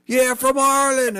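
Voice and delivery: high, proud mock answer; high, cheeky claim of being Irish; playing along. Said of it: "they would lose their shit".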